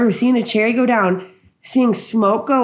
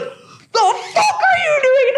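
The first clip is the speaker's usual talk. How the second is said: very high, drawn-out mock screech; imitated freak-out; much slower than usual. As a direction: imitate a friend losing it, stretched and squeaky high. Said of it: "Nick's freaking out".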